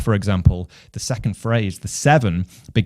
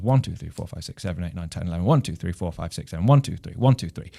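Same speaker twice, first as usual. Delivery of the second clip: rapid low count, every "One" punched above the other numbers; stress on each one; 4 semitones lower, 4 dB quieter, faster than usual. Shown as a demonstration. Drummer counting accents aloud for learners, stress on each "One". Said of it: "every accent falls on a one".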